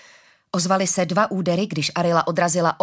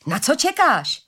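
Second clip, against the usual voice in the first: higher-pitched impatient question; 5 semitones higher, slower than usual. A narrator's reading. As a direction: demand it impatiently. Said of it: "zeptal se jí netrpělivě Suf".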